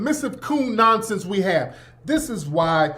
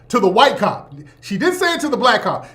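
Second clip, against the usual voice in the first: ordinary delivery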